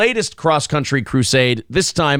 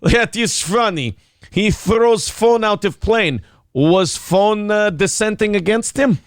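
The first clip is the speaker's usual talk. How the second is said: slow, higher mock Russian; deadpan a joke slowly in a Russian accent; Putin in Russian accent; 4 semitones higher, slower than usual. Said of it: "you can do a Russian accent".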